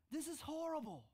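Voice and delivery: high, pushed quote of the wife; mock wife dismay. Wife hearing the piano for the first time, lightly voiced dismay. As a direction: give a hint of her upset.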